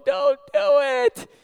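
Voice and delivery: very high, slow, drawn-out cry of don't; desperate plea. Retold or imitated. To the pastor giving away cash, high drawn-out plea. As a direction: beg him not to hand over the cash. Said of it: "I'm going".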